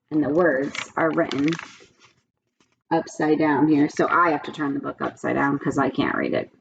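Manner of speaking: ordinary delivery, nothing marked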